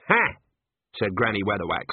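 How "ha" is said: high, loud scoffing ha; scornful ha. Acted. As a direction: scoff it out in disdain. Granny scoffs.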